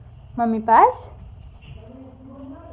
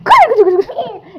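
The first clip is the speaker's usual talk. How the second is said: higher, louder, laughing sing-song of the name; playful laughing call; 8 semitones higher, 5 dB louder than usual. Real, not acted. Mother calls baby Golu, laughing and delighted.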